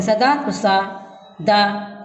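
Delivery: सा and दा said as separate syllables. Shown as a demonstration. Teacher breaking a word into syllables, each said alone. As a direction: break सदा into सा, a pause, then दा. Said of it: "इसके टुकड़े नहीं किए जा सकते हैं".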